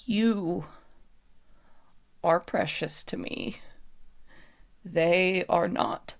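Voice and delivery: slow, deliberate pronouncement in short phrases; lightly voiced spirit, each phrase set apart; guarded puppet woman, solemn. Puppet woman answers plea to help the friends, deliberate and halting.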